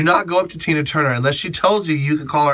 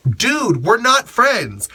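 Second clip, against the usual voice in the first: higher and slow, drawn-out dismissal; slow, exasperated dude we're not friends; annoyed brush-off; 8 semitones higher, much slower than usual. Retold or imitated. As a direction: shut him down with a slow, pointed brush-off. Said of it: "And I was like".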